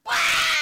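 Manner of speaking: loud, high mock wail; imitated child's crying scream. Father imitating his burned son, loud crying scream. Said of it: "He starts crying".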